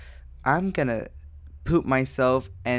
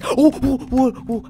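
quick high startled "oh" cries; string of loud, high yelps; panicked yelps; 10 semitones higher, 5 dB louder than usual. Real, not acted. Player being followed in the game, yelping in panic.

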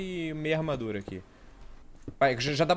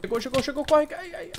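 panicked yelp; 14 semitones higher, 4 dB louder than usual. Real, not acted. Ambushed by players in game.

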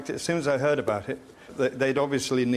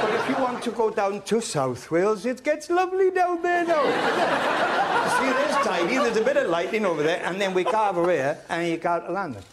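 put-on Welsh accent; 6 semitones higher than usual. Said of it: "I have a very subtle Welsh accent".

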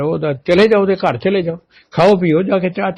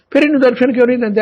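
urgent plea; 8 semitones higher, much faster than usual. Retold or imitated. Repeated plea to God.

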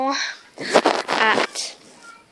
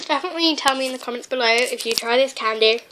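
usual speaking voice

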